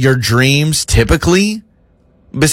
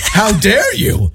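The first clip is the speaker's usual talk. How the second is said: indignant; 8 semitones higher, slower than usual. Retold or imitated. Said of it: "I was like".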